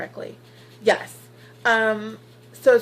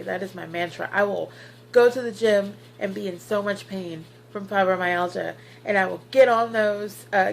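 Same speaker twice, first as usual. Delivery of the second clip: ordinary talk, nothing marked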